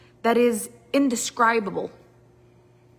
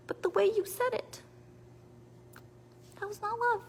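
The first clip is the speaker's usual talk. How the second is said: whiny hurt partner; 11 semitones higher, 7 dB quieter than usual. Retold or imitated.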